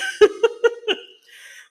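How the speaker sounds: high, loud burst of laughter. Real, not acted. Laughs at cat joke.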